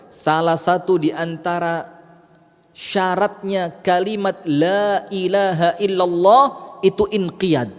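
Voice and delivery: usual speaking voice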